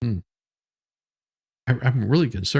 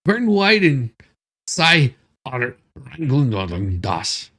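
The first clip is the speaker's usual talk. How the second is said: halting, harsh mock-foreign accent; mock "Canadian" foreign accent. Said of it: "that sounds Canadian to me".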